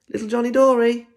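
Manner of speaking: high, loud register call; sing-song teacher roll call. Acted.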